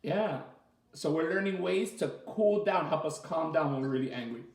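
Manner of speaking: ordinary delivery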